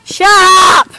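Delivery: high, loud shout of shut up. Real, not acted. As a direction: yell it at them. Snapping at twins.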